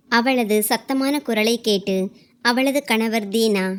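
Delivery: usual speaking voice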